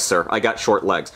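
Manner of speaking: ordinary delivery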